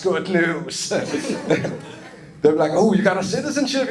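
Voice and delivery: ordinary talk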